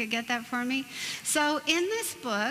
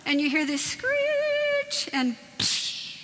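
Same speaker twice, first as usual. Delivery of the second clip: mock screech; 12 semitones higher, slower than usual. Car crash sound effect.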